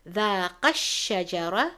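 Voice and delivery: "ذاق الشجرة" with the shin of "الشجرة" pressed hard; emphasized shin. Shown as a demonstration. Teacher demonstrating, recites "ذاق الشجرة" stressing the shin. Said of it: "emphasizing the letter shin".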